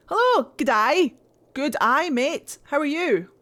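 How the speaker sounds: loud, high mock-Australian greeting; bad Aussie accent. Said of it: "I apologize for the shit Aussie accent".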